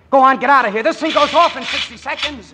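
high, loud yell to get her away; urgent shout. Acted. Soldier shouting at the lady to run.

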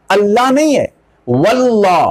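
ordinary talk, nothing marked

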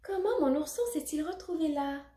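high-pitched puzzled question; surprised. A narrator's reading. Lucie finding her bear on floor, surprised. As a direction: wonder aloud in surprise. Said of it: "pense-t-elle surprise".

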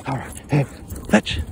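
usual speaking voice

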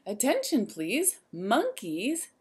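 plain reading voice

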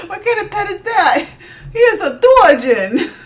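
high, giggly quoted question; half-laughing playful quote; laughing mock voice. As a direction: ask it through laughter.

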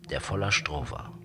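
plain reading voice

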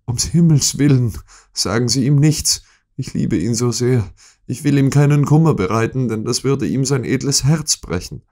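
high, fervent begging; imploring. A narrator's reading. Wife begs Holmes not to tell her husband, imploring. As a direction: beg desperately, pitched well above narration. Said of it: "bat sie inständig".

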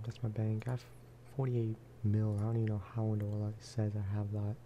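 usual speaking voice

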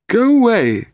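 high, loud cry of protest; cried out. A narrator's reading. Boat owner to late visitors, high indignant cry. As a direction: send them off in a high-pitched yell. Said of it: "cried Bryce Pemberton".

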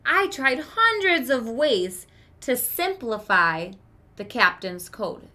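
lively read-aloud for a class; expressive story narration. Acted. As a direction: read the story to the children with character. Teacher reads story to class.

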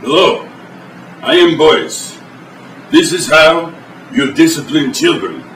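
broken-English Russian host, deadpan; mock Eastern European TV host; fake Russian accent. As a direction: play a stern Russian cook in broken English. Boris cooking show sketch.

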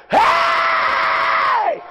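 high shouted call. Acted. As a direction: cry out to be heard over the crowd. Blind man calling out to Jesus.

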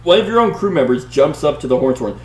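dramatic read-aloud narration; story narration. Acted. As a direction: read it like an adventure gamebook. Reads the gamebook story aloud.

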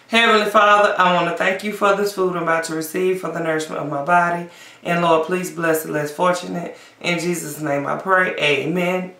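quick quiet mealtime prayer. Real, not acted. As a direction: say grace softly and fast. Saying grace before eating.

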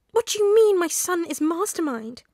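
high, loud, shrill demand; a shocked mother shrilling her disbelief; shrill question. A narrator's reading. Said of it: "Inko asked shrilly".

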